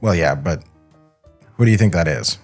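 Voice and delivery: normal talking voice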